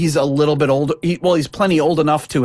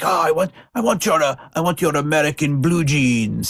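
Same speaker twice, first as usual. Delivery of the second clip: mock Russian accent; 3 semitones higher than usual. Russian buyer begging for smuggled jeans, mock Russian accent. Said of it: "That's my Russian, uh, accent".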